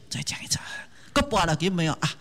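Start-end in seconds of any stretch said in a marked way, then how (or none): 0.1-0.6 whispered, soft repeat of the prayer. Acted. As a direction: murmur it under your breath to the gods. Praying again to busy gods.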